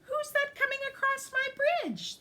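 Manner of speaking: friendly ogre greets the goat, high cheerful call. Acted. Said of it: "the ogre asked cheerfully".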